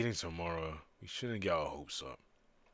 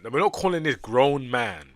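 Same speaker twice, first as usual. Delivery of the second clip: louder, insisting on not; exasperated protest; 6 semitones higher, 13 dB louder than usual. Real, not acted. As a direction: push back, already annoyed. Exasperated protest at game character.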